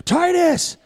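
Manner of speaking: high, loud cry of the name; companion's yell. Acted. Game master as Radish calling Titus, high yell. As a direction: call TITUS out in alarm. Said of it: "you hear Radish go".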